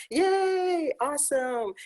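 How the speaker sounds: bright high yay, then awesome; excited cheer. Real, not acted. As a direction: cheer her arrival with real delight. Host cheers a scholar joining late.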